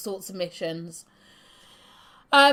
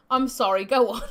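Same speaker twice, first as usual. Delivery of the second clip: ordinary talk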